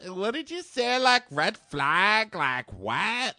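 high-pitched incredulous retelling; mock disbelief. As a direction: play up the shocked questioning, drawn out. Acting out shocked reaction to red flag.

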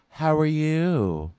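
slow, sing-song fake greeting; mock fake-friendly Dylan. Mocking Dylan's pretend friendliness, slow sweet greeting. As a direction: stretch out a fake sweet hello. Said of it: "Dylan starts his whole".

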